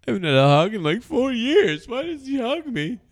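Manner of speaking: mock whiny teen diary voice. Friends joking about a diary, whiny teen voice. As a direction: whine it like a sulky kid writing in a diary.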